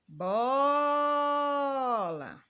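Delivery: "Bola" called loud, very long "bo"; calling out the word. Shown as a demonstration. Teacher showing the class the tonic syllable, "Bola" called out with "bo" held long. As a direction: call "Bola" as if hailing, holding the first syllable. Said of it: "pronunciar como se estivesse chamando a palavra".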